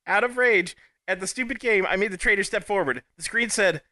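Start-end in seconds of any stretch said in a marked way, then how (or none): none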